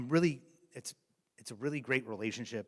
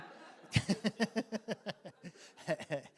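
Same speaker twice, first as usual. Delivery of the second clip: bright laugh; 10 semitones higher than usual. Real, not acted. Laughing at own joke.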